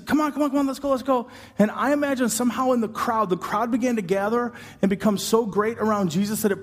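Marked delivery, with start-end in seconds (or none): 0.1-1.3 higher, pressing come on, come on; urgent hurry. Retold or imitated. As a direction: hurry him along, anxious and eager. Urgent father hurrying Jesus.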